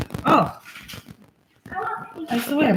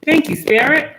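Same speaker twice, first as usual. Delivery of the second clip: delighted thanks; 8 semitones higher, 3 dB louder than usual. Real, not acted. Laughing gratitude to spirit.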